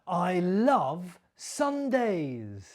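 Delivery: slow, with LOVE stressed and a falling end. Shown as a demonstration. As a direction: lean on "love" and let the line fall slowly. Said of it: "Did you copy my stress and my intonation".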